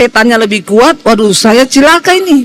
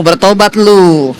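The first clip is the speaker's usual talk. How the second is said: lower, slow mock rebuke; mock scolding; 7 semitones lower, slower than usual. Mocking a preachy neighbour, slow low rebuke. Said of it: "bilang kanan kirimu".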